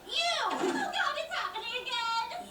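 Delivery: high-pitched panicky yelp; alarmed wail. Acted. Girl dreading the yelling girl behind her, high panicked wail.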